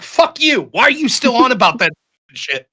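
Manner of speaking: a hammed-up irritated brush-off; mock angry president. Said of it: "And he's like".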